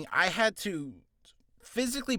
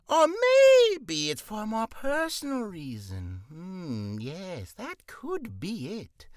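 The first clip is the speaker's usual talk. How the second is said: King Flabbergast; 3 semitones higher, much slower than usual. Acted. King musing aloud to Max Dougal, slow and scheming. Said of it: "let us do King Flabbergast".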